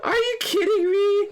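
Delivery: very high-pitched wailing cry; distraught disbelief. Real, not acted. Gamer reacting to Rekko's fate, wailing disbelief. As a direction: squeal it as a tearful protest. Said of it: "I already screamed".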